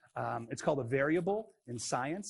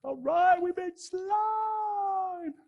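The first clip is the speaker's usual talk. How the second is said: much higher, very slow sing-song announcement; funny drawn-out voice; 5 dB louder, much slower than usual. Pretend. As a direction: stretch it out like a goofy game-show reveal. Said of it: "Why do I say it like that?".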